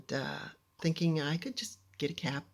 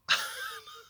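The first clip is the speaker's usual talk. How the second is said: high laugh; 3 dB quieter than usual. Real, not acted. Reaction to dad's joke.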